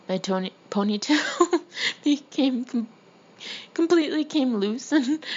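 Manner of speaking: narration breaking into giggles; laughing while reading. Real, not acted. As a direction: stumble over words as laughter rises. Laughs while reading own story.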